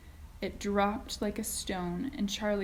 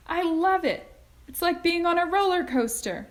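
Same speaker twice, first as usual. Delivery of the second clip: put-on high, louder thrilled kid; shouted with delight; 10 semitones higher, 6 dB louder than usual. A narrator's reading. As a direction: yell with joy on the ride. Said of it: "Charlie shouted".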